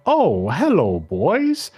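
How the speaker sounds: put-on swooping, sing-song old lady greeting. Host as old Belinda greeting the boys, old lady voice. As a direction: play a cooing old lady with big pitch swoops. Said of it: "that's my old lady voice".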